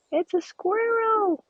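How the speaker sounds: thrilled high cry; excited squeal. Real, not acted. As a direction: squeal it with delight, slowly. Spots a squirrel in game.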